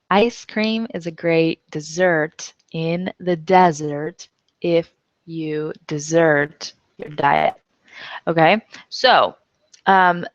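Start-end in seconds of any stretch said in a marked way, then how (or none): 0.1-7.5 x0.6 slow with "dessert", "desert", "desert" distinct; slow and careful, each stress marked; slow homograph demo. Shown as a demonstration. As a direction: repeat it slowly for students. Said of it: "This one is spelled with two S's".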